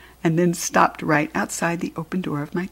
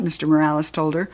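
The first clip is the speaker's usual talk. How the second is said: plain reading voice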